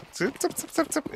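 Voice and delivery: high chick-chick call. Pretend. Calling like calling chickens.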